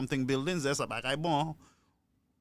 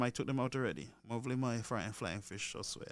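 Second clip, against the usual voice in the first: usual speaking voice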